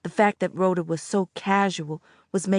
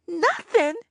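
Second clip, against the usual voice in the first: "Nothing" much higher, wailing, falling; wailed; 11 semitones higher than usual. A narrator's reading. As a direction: wail "Nothing" in dismay. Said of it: "I wailed".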